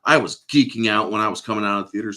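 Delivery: ordinary talk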